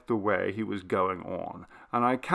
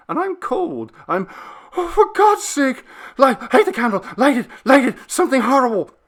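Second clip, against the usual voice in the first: high, loud and panicked, the voice cracking; a grown man's voice breaking into a frightened boy's; terrified, voice breaking; 13 semitones higher, 8 dB louder than usual. Acted. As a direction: beg for light in sheer terror. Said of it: "his voice broke".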